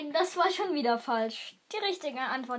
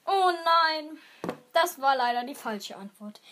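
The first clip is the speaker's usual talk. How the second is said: ordinary delivery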